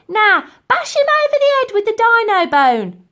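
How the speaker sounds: put-on high, loud old lady shout. A narrator's reading. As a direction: bark the order at full volume. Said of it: "shouted Dotty".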